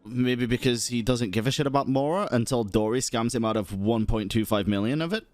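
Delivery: normal talking voice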